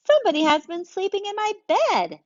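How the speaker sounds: a mom spotting her bed used; high outraged mother; indignant mommy. Acted. Mommy voiced in story.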